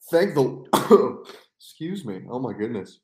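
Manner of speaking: coughing, choked on a drink; sputtering through a coughing fit; choking on water. Real, not acted. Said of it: "Choking on water".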